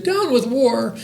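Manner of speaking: high, piping chant; hooting little dinosaur. A narrator's reading. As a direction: hoot it like a young dinosaur singing along. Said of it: "and he hooted".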